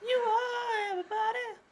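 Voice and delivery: high wailing dramatic cry; old radio show. Retold or imitated. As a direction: wail it like a radio melodrama. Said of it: "That's the line".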